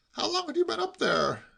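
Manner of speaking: high-pitched fresh question; pry in a high voice; inquisitive nephew. A narrator's reading. Nephew turning to other aspects, inquisitive.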